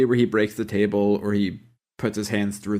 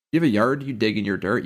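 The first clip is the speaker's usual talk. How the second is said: ordinary talk, nothing marked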